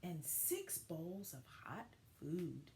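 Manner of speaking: gentle, expressive picture-book narration; reading to children. A narrator's reading. Teacher reading a story to her class, storybook voice. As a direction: tell it like story time for young kids.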